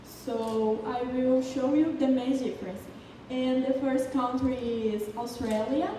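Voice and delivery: usual speaking voice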